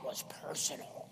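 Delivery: whispered "That was personal". Acted. Mock churchgoer suspecting the preacher, whispered. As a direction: hiss it like a secret. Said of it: "whispered mock paranoia".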